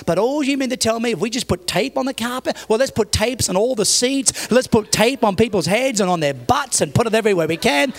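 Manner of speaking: retold exasperated sarcasm; sarcastic rant. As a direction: pile on absurd suggestions, building in pitch. Pastor's sarcastic reply in story.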